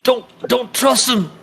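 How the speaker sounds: high, strained plea; urgent warning. Acted. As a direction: cry out a desperate warning. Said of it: "says Sildar".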